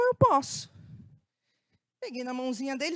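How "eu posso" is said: lightly voiced little boy, much higher; a hint of a shy five-year-old; small boy's voice. Teacher voicing the crying boy agreeing to come.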